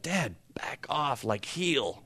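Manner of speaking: soft, slow mutter under the breath; muttered plea. Retold or imitated. Son mutters to his dad at Christmas, soft and slow. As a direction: hush him without a fuss. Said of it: "it's like".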